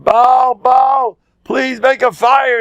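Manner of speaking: slow, high, loud pleading cry; desperate plea. Acted. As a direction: beg the idol desperately. Priests begging Baal.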